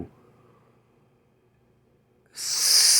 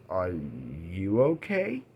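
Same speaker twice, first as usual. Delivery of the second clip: "Are you okay?" much softer and slow, rising gently; gentle concern; 7 semitones lower, 10 dB quieter, much slower than usual. Retold or imitated. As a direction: ask it tenderly, barely above a murmur. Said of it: "And she goes".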